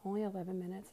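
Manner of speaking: normal talking voice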